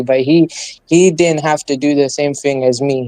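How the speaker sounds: normal talking voice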